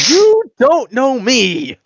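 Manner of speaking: very high, loud, drawn-out indignation; hammed-up outrage, every word stretched; outraged. Braggart repeats himself to the unimpressed creature, outraged. Affronted character escalates the repeat.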